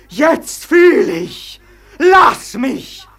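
a pleading shout that breaks loose; desperate outcry. Acted. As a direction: cry out to be let go. Claudio's outburst of longing for life.

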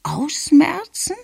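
very high, slow query; baffled grandmother. A narrator's reading. Grandmother asks what the word means, baffled and high. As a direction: stretch the word out high as a question.

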